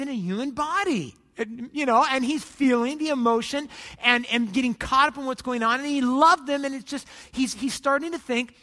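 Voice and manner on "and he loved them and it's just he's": ordinary delivery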